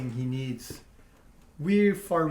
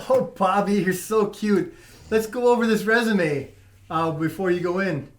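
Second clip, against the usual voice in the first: giggly little voice; 3 semitones higher, 6 dB louder than usual. Acted. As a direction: gush over Bobby like a smitten fan. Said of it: "in a giggly little voice".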